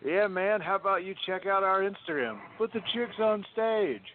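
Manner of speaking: hammed-up club host patter. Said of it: "make sure he's not an M C".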